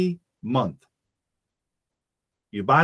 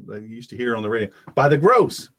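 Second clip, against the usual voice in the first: normal talking voice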